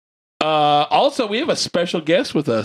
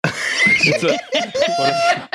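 high, slow words through laughter; laughing. Real, not acted. Laughing at the joke.

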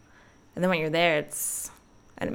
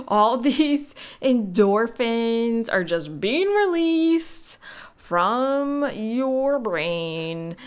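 slow, higher-pitched exaggerated delivery; drawn-out emphasis; 4 semitones higher, much slower than usual. Real, not acted. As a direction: stretch each word out with relish. Exaggerated relish about laughing.

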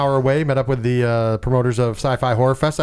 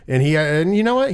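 ordinary talk

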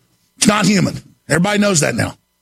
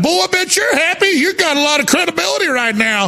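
mocking quote; 8 semitones higher than usual. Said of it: "everybody's like".

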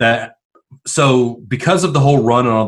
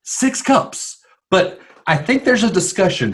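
hyper; 8 semitones higher than usual. Real, not acted. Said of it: "I'm a little hyper today".

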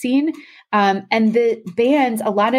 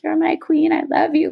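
a higher, gushing mock declaration of love; adoring gush; 9 semitones higher than usual. Singer's adoring line, quoted.

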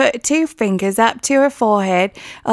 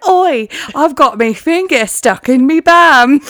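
louder mock Cockney with a rising end; hammed-up British accent; 4 dB louder than usual. Co-host joining the bit in a hammed-up British accent. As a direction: overplay a rough British bloke.

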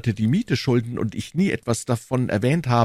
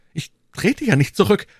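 mildly emphatic protest; a touch of forced bravery; exclaimed for courage; 11 semitones higher, 4 dB louder than usual. A narrator's reading. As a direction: call it out to bolster your own nerve. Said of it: "rief der Bürger, um sich Mut zu erwecken".

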